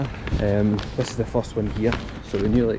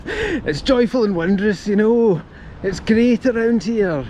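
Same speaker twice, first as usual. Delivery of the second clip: higher, slow, hammed-up Glaswegian Billy Connolly voice; playful Scottish comedian impression; Billy Connolly accent; 9 semitones higher, 3 dB louder, slower than usual. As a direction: ham up a Glasgow comic's lilt. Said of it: "I nearly broke out into a Billy Connolly accent".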